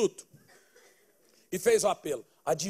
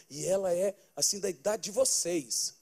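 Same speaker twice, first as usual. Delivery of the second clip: normal talking voice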